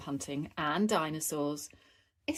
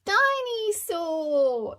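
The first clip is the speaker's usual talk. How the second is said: put-on much higher, very slow babyish cry; toddler blurting; 12 semitones higher, 5 dB louder, much slower than usual. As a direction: jump in eagerly like a little boy. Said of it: "interrupted George".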